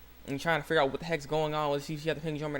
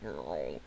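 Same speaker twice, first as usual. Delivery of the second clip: low, soft imitated tummy rumble; stomach growl imitation; 13 semitones lower, 8 dB quieter than usual. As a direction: let a hungry growl roll out very low. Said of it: "stomach's all hungry".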